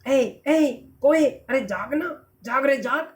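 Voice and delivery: coaxing call to a sleeper; gently acted rousing of the crow; waking someone. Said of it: "पेड़ कोए को जगाता है". (सोते कौवे को जगाते हुए)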